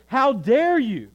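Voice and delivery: an insulted mother rebuking Jesus, loud and indignant; indignant outrage. Pretend. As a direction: bristle with hurt pride, nearly shouting. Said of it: "She does not say".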